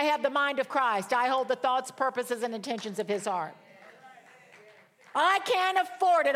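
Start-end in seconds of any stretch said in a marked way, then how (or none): 5.1-6.4 mock complaint. Voicing a worried complaint.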